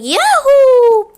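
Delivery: high, loud gleeful whoop; Mario cheer. Retold or imitated. Mario cheering after squishing Freddy, high loud whoop. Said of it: "Then Mario's like".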